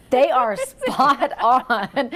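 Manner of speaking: slow, drawn-out, laughing praise; laughing tease. Real, not acted. Anchor teasing colleague about dance moves, slow and laughing. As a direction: stretch it out as laughter breaks in.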